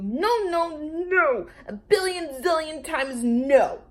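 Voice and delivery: high, loud repeated no; yelling. A narrator's reading. Lulu protesting, yelling no. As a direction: shout it again and again. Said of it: "yelled Lulu".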